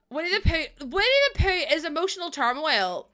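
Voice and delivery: usual speaking voice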